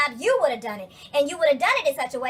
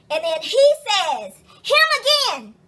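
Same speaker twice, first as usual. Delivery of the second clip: exasperated; 6 semitones higher, 7 dB louder, slower than usual. Real, not acted. Exasperated at him again.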